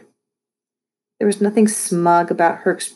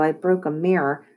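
usual speaking voice

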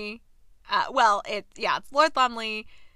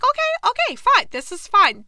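squeaky high, loud forced calm; mock panic; 9 semitones higher, 7 dB louder than usual. Recapper mocking her own reaction, squeaky mock-calm panic. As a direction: pretend everything is fine while panicking.

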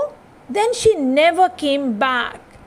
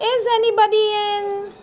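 higher, quick timid call into the house; little girl calling; 6 semitones higher, faster than usual. A narrator's reading. Curly Locks calling into the empty cottage, curious. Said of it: "she asked".

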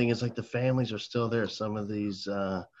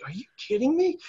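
high, loud, amazed retelling; thrilled disbelief; 5 dB louder than usual. Retold or imitated. Kid offered a Coke during class, thrilled disbelief. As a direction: imitate an amazed kid, voice shooting up.

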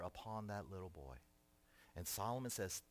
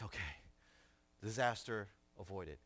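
relieved father; 4 semitones lower than usual. Acted. Father's relieved thought after giving toy.

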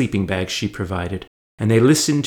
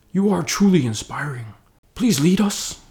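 higher, admiring hobbit voice; awed hobbit; 8 semitones higher than usual. A narrator's reading. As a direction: gush with lightly voiced awe. Sam voiced in awe.

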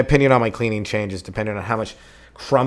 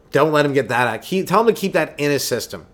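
ordinary talk

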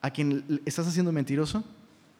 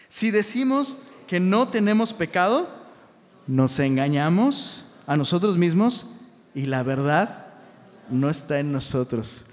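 ordinary delivery

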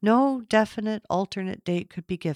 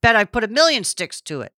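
lightly voiced boast, a bit louder; bragging; 3 semitones higher, 6 dB louder than usual. A narrator's reading. Old Duncan boasts about the stove, mildly proud. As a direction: puff up with pride. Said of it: "Duncan bragged".

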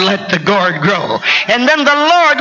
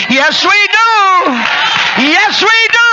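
preaching at full force; 9 semitones higher than usual. Acted. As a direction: ring it out to the congregation. Preacher's affirmation.

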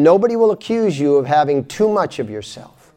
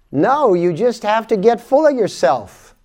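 ordinary talk, nothing marked

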